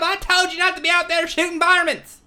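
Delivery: high, loud comic telling-off; mock furious backwoods scolding; hammed-up scolding mother-in-law. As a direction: holler at your fool son-in-law.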